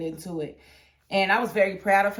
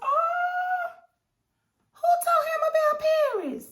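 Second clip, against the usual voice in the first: very high, louder gasping outrage; shocked; 3 dB louder than usual. Retold or imitated. Fan acts out her own shocked reaction to the boss. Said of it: "I was like".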